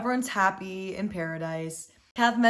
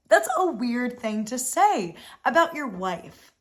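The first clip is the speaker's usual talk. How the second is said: ordinary talk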